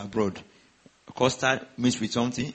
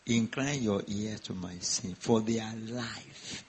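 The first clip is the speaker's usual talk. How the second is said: slow, soft, weighty reading of the verse; slow scripture quote; 2 semitones lower, 5 dB quieter, slower than usual. Acted. Preacher quoting scripture to the congregation, slow and soft. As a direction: lay the verse out slowly and gently.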